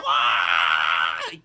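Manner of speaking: a loud, low, hammed-up scream of falling; mock terror scream in the tornado. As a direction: bellow it like the car is hurtling through the air. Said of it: "Just like that".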